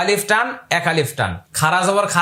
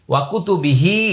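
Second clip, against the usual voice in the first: ওয়া কুতুবিহি in one unbroken phrase; joined up. Shown as a demonstration. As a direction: link "ওয়া" straight into "কুতুবিহি". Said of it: "আমি প্রত্যেকটা আলাদা আলাদা করে বলছি". (ওয়া কুতুবিহি একসাথে মিলিয়ে পড়া)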